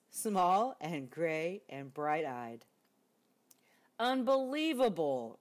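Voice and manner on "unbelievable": loud, higher exasperated bear; rumbled. Acted. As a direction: rumble it in disbelief. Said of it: "rumbled Bear".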